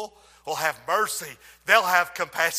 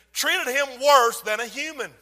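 ordinary talk, nothing marked

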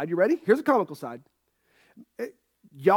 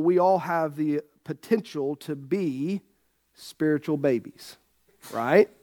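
ordinary talk, nothing marked

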